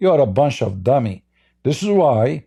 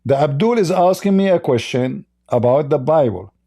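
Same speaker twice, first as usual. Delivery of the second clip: normal talking voice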